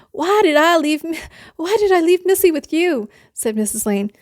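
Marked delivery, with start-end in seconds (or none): 0.1-3.1 exasperated scolding. Acted. Mother scolding the sitter.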